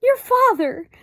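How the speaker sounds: flustered mother exclaiming; high flustered exclamation; excited exclamation. A narrator's reading. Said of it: "exclaimed Homley".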